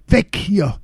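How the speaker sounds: panicked cry. A narrator's reading. Lea warns her friends of the dog, panicked high cry. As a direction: yell at the others to run in panic. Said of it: "rief Lea voller Panik".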